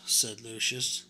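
ordinary delivery

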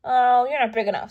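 loud dismissive scoff; grouchy ladybug. A narrator's reading. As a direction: scoff and fly off. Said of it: "said the grouchy ladybug".

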